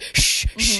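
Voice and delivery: loud, forceful shush; mock scolding hush; imitated angry shushing. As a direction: shush like an irritated dad. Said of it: "he started yelling at his son".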